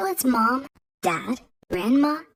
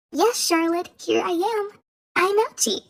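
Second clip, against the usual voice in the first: put-on higher, sweet little fairy girl; sweet tiny voice; 7 semitones higher than usual. A narrator's reading. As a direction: sound small and sweet, like a cartoon sprite. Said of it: "In a sweet, tiny voice".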